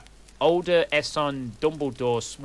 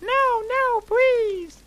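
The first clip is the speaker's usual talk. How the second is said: squeaky, very high begging; high pleading; 5 dB louder, slower than usual. Acted. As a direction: plead in a tiny falsetto. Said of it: "we begged sadly".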